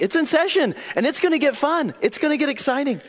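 high, excited burst from the preacher; excited hype. Real, not acted. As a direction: hype up the room, voice leaping up. Preacher hyping the coming lesson.

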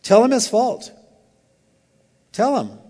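punchy, commanding delivery; firm command. Acted. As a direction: give it as a direct command. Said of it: "this is a command".

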